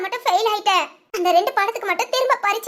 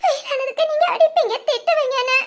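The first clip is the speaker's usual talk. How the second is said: tearful relief; 5 semitones higher than usual. Acted. Daughter to her father after failing, tearful relief. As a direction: voice higher, half crying, half laughing.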